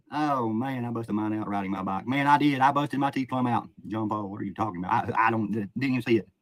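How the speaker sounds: usual speaking voice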